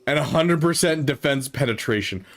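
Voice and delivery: ordinary delivery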